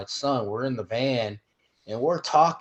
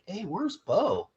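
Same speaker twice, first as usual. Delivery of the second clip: slow, high, startled question; realizing someone is missing, drawn out high; surprised "where's Bo"; 8 semitones higher, much slower than usual. Retold or imitated. Friends in the van suddenly missing Bo, startled high question. Retelling realizing son was left.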